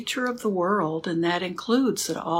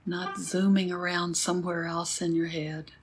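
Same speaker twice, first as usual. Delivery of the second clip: guided meditation; 4 dB quieter than usual. Acted. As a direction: guide the sitting gently, with long pauses. Leads a sitting meditation.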